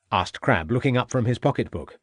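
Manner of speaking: ordinary delivery, nothing marked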